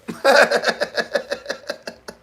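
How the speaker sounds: a loud high laugh bursting out. Real, not acted. Laughing hard while telling friends the story. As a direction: laugh out loud at your own threat. Laughing at his own threat.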